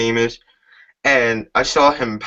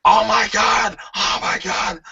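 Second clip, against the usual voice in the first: Jim Ross impression; 10 semitones higher than usual. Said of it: "J R would be saying".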